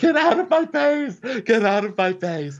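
high, loud hammed-up order; mock shrieking. Host mimics housewife wanting space, mock shriek. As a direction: screech it like a cornered housewife. Acted screaming match between housewives.

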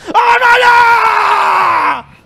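high, drawn-out shriek. Retold or imitated. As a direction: shriek in alarm.

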